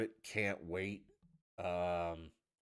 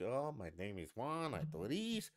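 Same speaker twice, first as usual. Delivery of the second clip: slow, mock Spanish accent; singsong imitation of a Spanish accent; 4 semitones higher, slower than usual. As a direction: put on a broad Spanish accent throughout. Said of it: "have an accent the whole time".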